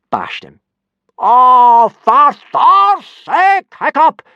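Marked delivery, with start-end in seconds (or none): 1.2-4.2 very high, loud, dragged-out outburst; exploding. Acted. Gobber exploding at Hiccup, very high and loud. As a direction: blow up at him in exasperation. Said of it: "exploded Gobber".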